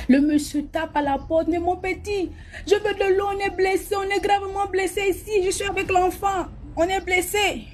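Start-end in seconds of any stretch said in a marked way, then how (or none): 1.5-7.6 much higher, thin pleading voice; a stranger knocking, begging for water in a high voice. Retold or imitated. Man at door begging for water.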